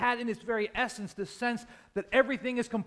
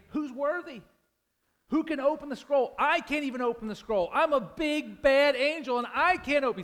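big, higher-pitched mighty angel voice; beefy angel voice; 6 semitones higher, 4 dB louder than usual. Acted. A mighty angel calling out to heaven, beefy and higher. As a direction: puff up like a muscle-bound angel. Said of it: "beefy voice guy saying".

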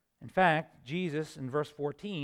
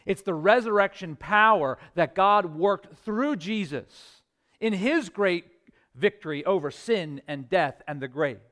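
louder, driving proclamation; triumphant preaching; 2 semitones higher, 6 dB louder than usual. Acted. Preacher proclaiming resurrection to the congregation, triumphant full-force preaching. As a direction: proclaim the victory with conviction. Sermon climax.

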